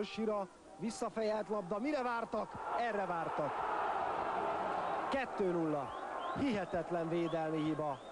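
high, urgent play-by-play build; excited goal call. Real, not acted. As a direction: ride the thrill of a goal. Commentator calls the second goal.

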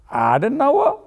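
viel höher, ungläubig nachgefragt. A narrator's reading. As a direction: kann kaum glauben, was er hört. Landrat ungläubig am Telefon. (ungläubig hochgezogen)

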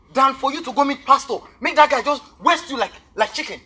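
furious warning to a stubborn friend; angry warning. Acted. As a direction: shout the danger at him. Warning friend off seeing pastor.

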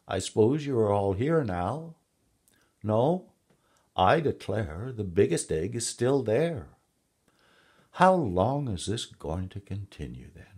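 exasperated storybook duck; mother duck. A narrator's reading. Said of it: "said the mother".

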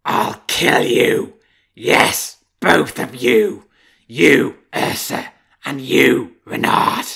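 very loud, slow roaring threat; savage threat. Acted. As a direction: roar death threats down the well. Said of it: "he swore and threatened".

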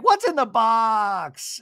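high, loud, drawn-out question; mock dramatic cry. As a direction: wail it with hammed-up drama. Acting Jen's dramatic question.

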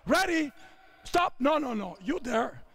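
ordinary talk

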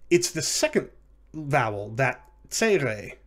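ordinary talk, nothing marked

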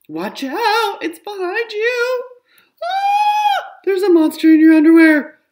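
shrieking high and loud in mock panic; alarmed warning. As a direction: yell the warning like the monster is right there. Child warns siblings of monsters.

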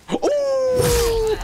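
very high, drawn-out "ooh"; long falsetto whoop of amazement; excited whoop. Real, not acted. Gamer reacting to a cool move, high excited whoop.

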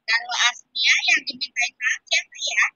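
hushed voiceless question; whisper. Real, not acted. Teacher whispering a gentle question to a child.

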